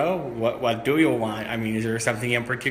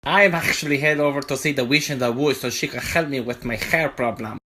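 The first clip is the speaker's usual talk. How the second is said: ordinary talk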